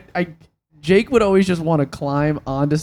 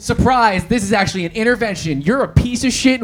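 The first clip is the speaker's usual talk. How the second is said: mock accusation; 8 semitones higher, 4 dB louder than usual. Mock intervention ambush.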